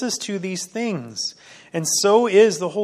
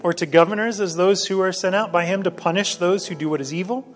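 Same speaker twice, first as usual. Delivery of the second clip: louder, measured Bible reading; scripture reading; 2 semitones higher, 5 dB louder than usual. Acted. Pastor reading First Peter to the church, louder and measured. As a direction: proclaim the passage to the congregation. Reads scripture passage aloud.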